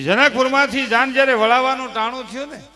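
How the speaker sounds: loud, high, swelling sermon cry; impassioned preaching. Acted. Preacher telling listeners why daughters' farewells bring tears, impassioned. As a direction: lift it like a preacher moving the crowd.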